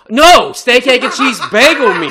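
loud, laughing correction of the order; yelling the order right, half laughing; exasperated shout. Retold or imitated. Shouting the order at the drive-thru speaker, exasperated. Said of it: "correcting drive-thru worker's wrong order".

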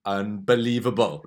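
loud mock Welsh accent. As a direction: belt it in a fake Welsh lilt. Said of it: "say things in a Welsh accent".

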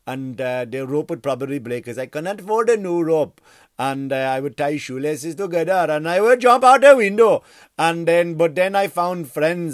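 put-on comic Indian accent. Comedian imitating a fan's horrible story for laughs, mock Indian accent. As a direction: tell it in an exaggerated Indian accent. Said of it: "I can do an Indian accent now".

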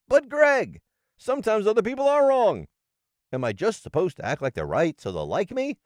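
high, pleading protest; whiny objection. Pretend. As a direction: push back like a defensive listener. Imagined listener objecting to host.

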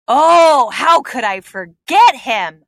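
ordinary talk

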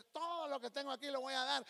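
high-pitched zealous vow; bold faith, acted. Believer moved to give everything, hammed-up high zeal. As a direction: declare it with exaggerated fervour. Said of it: "Y usted hasta saca fe y dice".

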